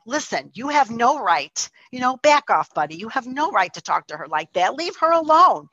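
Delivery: retold telling-off, rising to high and loud; going at him. Woman defending crying friend from cowboy, angry retold outburst. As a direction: act out a firm warning, building to the final order. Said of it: "I go at him".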